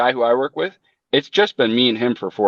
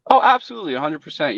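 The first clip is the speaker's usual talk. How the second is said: imitating a keen customer, pitched way up; eager agreement; 6 semitones higher, faster than usual. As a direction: agree in a high, gushing mock-customer voice.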